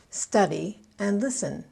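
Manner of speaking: "study and listen" as STU-dy and LIS-ten; stress on first syllables. Shown as a demonstration. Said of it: "I stress the first syllable in both verbs".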